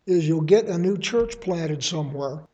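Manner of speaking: usual speaking voice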